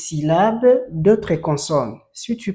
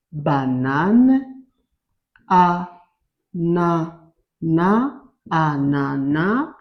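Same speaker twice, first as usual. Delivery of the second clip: slow syllables; much slower than usual. Shown as a demonstration. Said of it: "reads word slowly, syllable by syllable".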